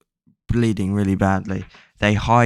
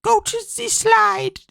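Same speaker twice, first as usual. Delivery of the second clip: much higher, slow put-on announcer voice; a hammed-up tannoy order; mock PA announcer; 4 dB louder than usual. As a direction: play the creepy voice over the PA. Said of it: "is like".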